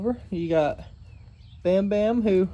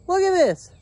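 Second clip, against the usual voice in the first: higher, louder, jolted exclaim; startled call; 9 semitones higher, 8 dB louder than usual. Real, not acted. Startled farmer calls to viewers about the dog. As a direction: blurt it out, still shaken by the scare.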